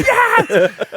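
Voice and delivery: loud, high whoop of triumph; a mock-joyful roar of a team out of sight; celebratory shout. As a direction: punch the air and yell yes. Celebrating behind closed doors.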